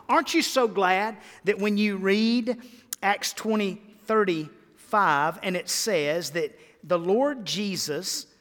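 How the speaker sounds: ordinary delivery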